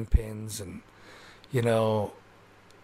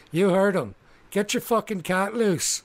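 angry halfling demand; 7 semitones higher than usual. Acted. Halfling telling the party to free Mickey, angry demand. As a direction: snap the order at them.

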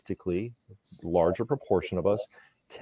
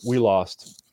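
ordinary talk, nothing marked

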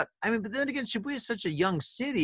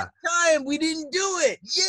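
excited; 12 semitones higher, 3 dB louder than usual. Retold or imitated. Excited relief continues.

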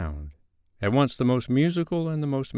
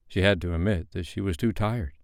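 usual speaking voice

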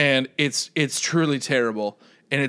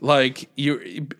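normal talking voice

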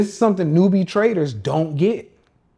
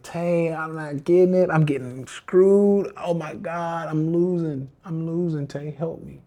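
pleading losing trader; slower than usual. Retold or imitated. Losing trader begs Tay for help.

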